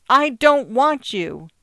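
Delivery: loud, high, slow furious scream; child's scream. A narrator's reading. Boy screaming at his parents, furious shout. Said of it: "He screamed at his parents".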